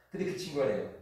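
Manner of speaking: ordinary delivery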